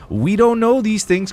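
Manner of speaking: high, loud, slow mock mystery; mock dramatic. Teasing chat about a name, mock dramatic. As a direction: ham it up like a conspiracy.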